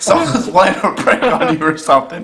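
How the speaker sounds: can't keep the laugh down; laughing while talking. Real, not acted. Prankster caller telling the victim it's a prank, giggling. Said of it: "I'm not trying to laugh".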